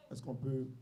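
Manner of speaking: soft, hushed prayer. Acted. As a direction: pray quietly and humbly.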